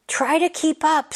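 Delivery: high, bright cub voice; a cub teasing him to hurry along; calling to her brother. Acted. Tara calling to Tejas, lightly voiced cub. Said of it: "said Tara".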